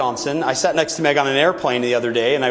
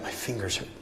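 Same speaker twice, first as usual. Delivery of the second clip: soft pained murmur; 2 semitones lower, 12 dB quieter than usual. Retold or imitated. Speaker repeats injured Meg's words, hushed and weak. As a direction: murmur it weakly.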